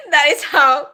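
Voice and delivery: giggling helplessly mid-sentence; laughing through words. Real, not acted. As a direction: laugh the line out, barely holding it together. Laughing at her own dream.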